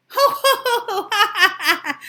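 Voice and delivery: high loud ho ho ha ha; mocking laugh. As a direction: laugh like jeering pigs. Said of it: "laughed the two lazy little pigs".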